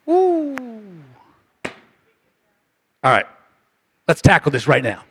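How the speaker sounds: ordinary talk, nothing marked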